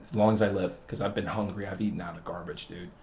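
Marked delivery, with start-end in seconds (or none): none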